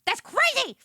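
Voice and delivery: That's crazy squeaked high and loud. Real, not acted. Repeating the phrase, squealing That's crazy high and loud.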